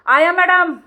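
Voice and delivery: loud call from downstairs; security guard yelling up four floors; shouted call. Retold or imitated. Guard shouting up from below, loud call. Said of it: "ज़ोर से चिल्ला के बोला".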